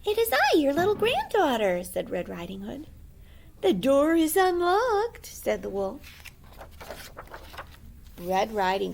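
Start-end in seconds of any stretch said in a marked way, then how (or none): 0.0-1.8 put-on high, bright-eyed little girl. 3.6-5.3 high, slow fake granny; wolf's fake granny voice. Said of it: "his sweetest Granny voice".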